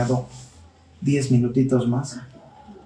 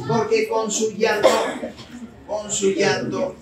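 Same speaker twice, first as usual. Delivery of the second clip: high, lightly wailing delivery; a touch of hammed-up lament; mock tearful; 7 semitones higher, slower than usual. Preacher explains the lost sheep's cry, mock tearful.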